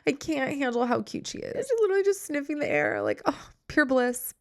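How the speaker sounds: high, squealing over the cat; overwhelmed by cuteness. Real, not acted. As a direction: gush like it's unbearably cute. Gushing over cat video.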